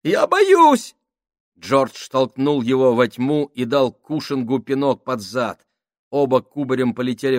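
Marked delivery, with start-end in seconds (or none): 0.0-0.9 high panicked wail; sobbing in fear. A narrator's reading. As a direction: wail it in terror. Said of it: "всхлипнул Сольц".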